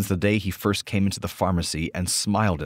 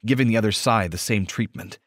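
ordinary delivery

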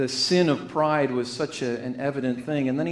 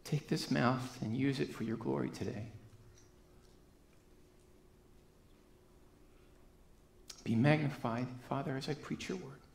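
soft, hushed opening prayer before the sermon; quiet prayer; 7 dB quieter than usual. Real, not acted. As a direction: pray quietly to the Father, reverent.

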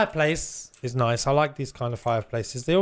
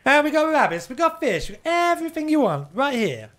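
market trader calling out; 12 semitones higher than usual. Retold or imitated. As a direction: call out your wares like a market stall seller. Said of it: "like you're a market trader".

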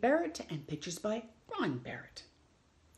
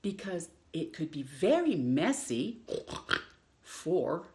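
lively picture-book read-aloud with long pauses; reading to children; slower than usual. Acted. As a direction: read it like story time for little ones.

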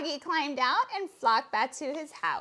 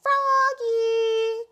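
long, high, loud holler; calling out; 9 semitones higher, 8 dB louder than usual. A narrator's reading. Mother calls Froggy in to swim, drawn-out loud call. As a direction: call the name out across the pond. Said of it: "caught his mother".

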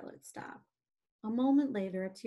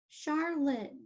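higher, drawn-out mild wail; moaning; 6 semitones higher, 4 dB louder than usual. Acted. Wilbur grieving for his dying friend, moaning. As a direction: moan the name in acted sorrow. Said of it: "he moaned".

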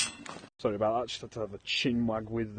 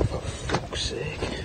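whispered swear; 4 dB quieter, much slower than usual. Real, not acted. Frustrated under breath.

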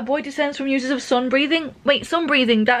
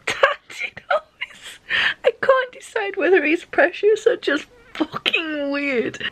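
very high, laugh-choked words; talking through giggles, barely holding it; helpless laughter; 7 semitones higher than usual. Real, not acted. Reader cracking up at Tanjiro, laughing through words.

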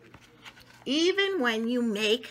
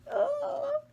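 crying oh. Shown as a demonstration. Shows the list of emotions.

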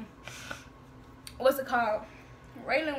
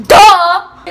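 much louder, higher mock yell; exasperated shout; 6 semitones higher, 22 dB louder than usual. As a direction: blast it out like it's obvious. Acting out own exasperated reply.

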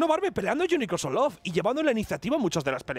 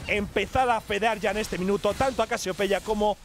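sustained sing-song fight call, winding down; esports play-by-play; 6 semitones higher than usual. Acted. Caster calls a team fight and score, excited play-by-play. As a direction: rise through the fight, then settle to sum up. Live match casting.

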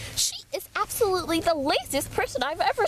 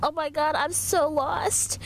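ordinary delivery

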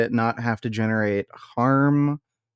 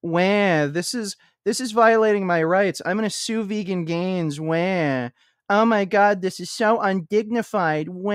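whiny high mock-crying voice, higher; mock crybaby; 7 semitones higher than usual. Streamer mocking her as a whining crybaby, high. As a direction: parody her as a wailing crybaby. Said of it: "make a fucking video crying about it".

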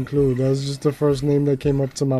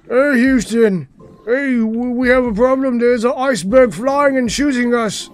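high-pitched British pilot radioing trouble; British accent; 9 semitones higher, 4 dB louder than usual. Pretend. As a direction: report the danger in a hammed-up British voice. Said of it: "Why would a British person call Houston?".

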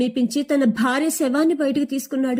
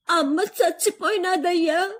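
crying; 5 semitones higher than usual. A narrator's reading. Daughter tells father mother died, high tearful wail. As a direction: sob it out to her father, voice lifted and thin. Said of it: "ఏడుస్తూ".

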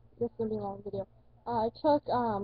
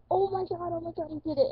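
higher, excited disbelief; relieved excitement; 6 semitones higher than usual. Retold or imitated. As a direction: squeal out that you did it.

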